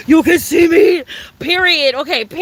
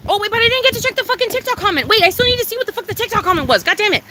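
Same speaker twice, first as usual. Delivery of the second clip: high, fast sudden realization; hurried; 6 semitones higher, faster than usual. Real, not acted. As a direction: rush it out, suddenly remembering.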